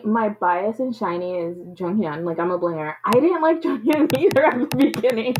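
ordinary talk, nothing marked

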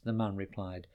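plain reading voice

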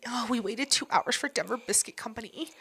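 hushed, near-whispered imitation; mock breathy brag. Host imitating the guest explaining brunch, in a mocking whisper.

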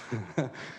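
low, soft chuckle; quiet laugh to himself; brief amused chuckle. Real, not acted. Laughs at his own admission.

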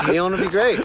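loud, high eager agreement; hammed-up enthusiasm; mock eager. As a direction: oversell it brightly. Acting eager agreement in retold scene.

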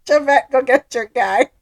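playful shout-out; calling out. Acted. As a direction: call her out across the room. Teasing call to co-host.

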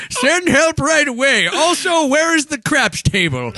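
high, drawn-out mock call for help; hammed-up old man phoning in an emergency; injured old caller. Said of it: "Can you imagine the call?".

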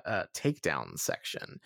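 normal talking voice

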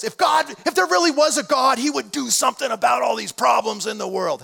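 high, loud, indignant skeptic; imitating a skeptic. Preacher voicing a scoffer who says God would fix the world. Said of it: "heard somebody say that".

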